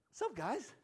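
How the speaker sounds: put-on high, light little-girl voice; comic, high-pitched girlish hello; revived little girl. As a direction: chirp a casual greeting in a high voice. Revived little girl greets everyone.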